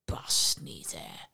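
whispered from inside the jar; a djinn puffing, barely voiced; muffled puffing djinn. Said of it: "hoorde de visser de djinn nog puffen". (gefluisterd, puffend uit de kruik)

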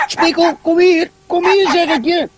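high-pitched call to a dog. A narrator's reading. A boy calling his dog Spikkel back, high-pitched. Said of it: "gilt Job plotseling".

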